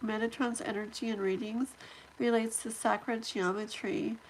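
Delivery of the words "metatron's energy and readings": ordinary talk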